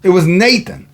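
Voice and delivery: preaching. Acted.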